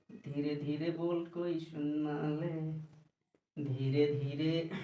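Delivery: a slow hushed demonstration; softly, slowly. Shown as a demonstration. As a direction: say it low and hushed so nobody overhears. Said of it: "जैसे धीरे धीरे".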